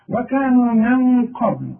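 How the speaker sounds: loud recited "وكانوا من قبل", nun hidden before qaf; ikhfa before qaf. Shown as a demonstration. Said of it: "نون بعدها قاف".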